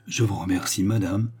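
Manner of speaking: ordinary delivery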